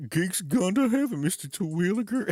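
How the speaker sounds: very high-pitched yokel voice with an odd twang; squeaky country cousin breaking sad news; high hillbilly voice. Retold or imitated. Cousin Merle telling Mr. Terwilliger the dog died, high hillbilly voice. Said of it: "his cousin Merle goes".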